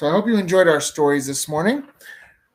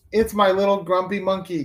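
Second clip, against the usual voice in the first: cried out; 7 semitones higher, 4 dB louder than usual. A narrator's reading. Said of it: "Jim's mom cried".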